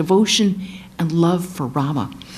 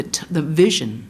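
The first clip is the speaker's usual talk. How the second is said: usual speaking voice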